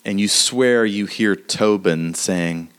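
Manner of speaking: ordinary delivery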